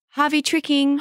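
put-on high toddler voice; imitated gleeful toddler. Mother quoting her toddler's trick, playful child voice. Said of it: "He's smiling and laughing and he says".

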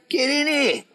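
high-pitched mock call to come in; imitating eager summons. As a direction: wave the gang inside, pitch jumping up. Rotten waving the group inside, retold.

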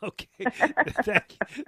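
laughing thank you. Real, not acted. Host laughs at caller's wish.

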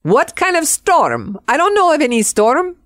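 high-pitched imitation of a Spanish accent. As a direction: play her puzzled, in a high Spanish accent. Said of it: "in her Spanish accent".

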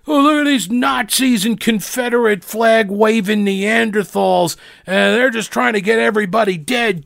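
high, sneering mock voice of a gleeful leftist. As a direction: ham up a smug liberal cheering. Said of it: "The left reaction to this is".

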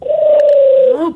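high, eerie loon cry; loon call. Shown as a demonstration. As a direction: hold one long wailing loon note. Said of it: "the best loon call I've ever heard".

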